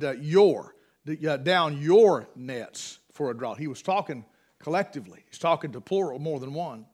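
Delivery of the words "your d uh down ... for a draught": "Your" held long and falling; slow weighted "Your"; stress on your. Shown as a demonstration. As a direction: stretch out "Your" to mark plural. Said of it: "he said".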